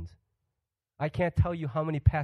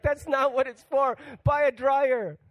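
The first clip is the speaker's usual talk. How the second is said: higher, indignant mock protest; mock indignation; 9 semitones higher, 4 dB louder, slower than usual. Treadmill owner scolding the family, higher mock indignation. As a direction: act offended that the treadmill is misused.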